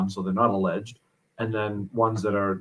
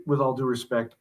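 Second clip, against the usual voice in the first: ordinary talk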